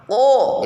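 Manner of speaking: ko dengan suara jelas; qaf jahar. Shown as a demonstration. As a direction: ko bersuara penuh. (ko jahar)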